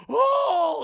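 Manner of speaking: high, loud mock scream; hammed-up frightened wail; terrified cry. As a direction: cry out as if startled by a swarm. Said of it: "They're all terrified of this".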